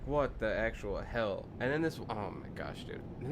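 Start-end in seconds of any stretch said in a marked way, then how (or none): 0.1-1.5 slow, drawn-out incredulous question. Retold or imitated. Reacting to forum hatred of furniture, slow incredulous disbelief. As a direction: let the disbelief stretch out. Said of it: "And I was like".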